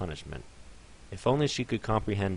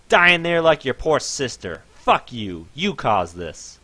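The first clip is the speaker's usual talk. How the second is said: much louder, ending slow and heavy; furious yelling; 6 semitones higher, 11 dB louder, slower than usual. Acted. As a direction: curse the pony through the lid. Owner yells into box.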